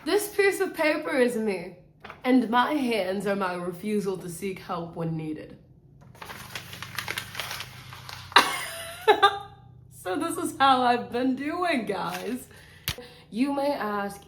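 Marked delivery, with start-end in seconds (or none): 6.2-9.5 a high silent wheeze of a laugh; high airless laugh. Real, not acted. Laughing at her own paper metaphor, high and voiceless.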